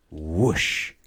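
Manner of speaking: a loud rushing whoosh. Acted. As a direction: make the sliding noise, loud. Slipping on the ice.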